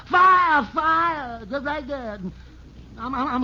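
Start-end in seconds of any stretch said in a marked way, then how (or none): 0.1-1.4 shouted cry of fire, the second fading; yelling fire. Retold or imitated. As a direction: yell out a fire alarm in panic. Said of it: "she yelled".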